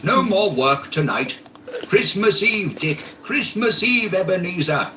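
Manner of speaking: put-on rally the boys merrily; jovial Fezziwig. A narrator's reading. Fezziwig calling his apprentices, jovial shout. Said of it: "cried old Fezziwig".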